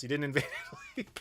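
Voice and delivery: fast, breaking into laughter mid-line; laughing through it. Acted. Teasing British viewers about Italy, laughing through it. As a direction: repeat the jab while cracking up.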